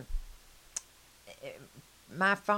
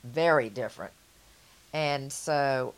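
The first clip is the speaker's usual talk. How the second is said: ordinary delivery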